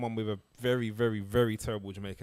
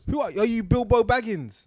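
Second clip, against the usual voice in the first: loud high teasing; 11 semitones higher, 7 dB louder than usual. Real, not acted. Teasing reply.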